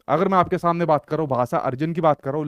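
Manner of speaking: usual speaking voice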